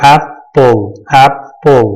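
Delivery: एप्पल broken into ap, pause, pol; two separate beats on एप्पल; split into syllables. Shown as a demonstration. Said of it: "ऐप और पल".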